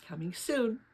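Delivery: ordinary delivery, nothing marked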